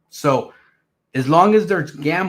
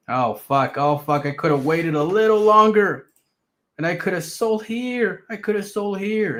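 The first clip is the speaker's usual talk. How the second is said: higher, mock-panicked regret; regretful seller; 6 semitones higher than usual. Mocking the sellers who missed out, higher panicked whine. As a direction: ham up a trader kicking himself.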